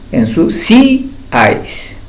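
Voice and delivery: "ensuciáis" very slow, long í held apart from "áis"; stressed, broken triphthong. Shown as a demonstration. Teacher showing the weak vowel accented, slow "ensuciáis" stressed on í. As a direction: split "ensuciáis" after a drawn-out stressed í. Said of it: "porque la vocal débil está acentuada".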